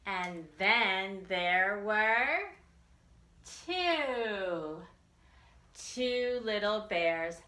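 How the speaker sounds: bouncy nursery rhyme read aloud, drawn out before each number; singsong rhyme. Acted. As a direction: chant it for little kids.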